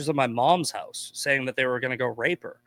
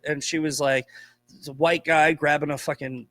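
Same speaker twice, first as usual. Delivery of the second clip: ordinary delivery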